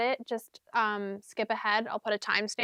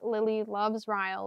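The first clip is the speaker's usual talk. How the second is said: ordinary talk, nothing marked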